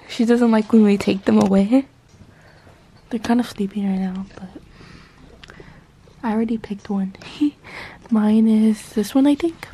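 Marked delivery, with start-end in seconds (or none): 3.1-7.1 hushed so the pets sleep on; whispering. Real, not acted.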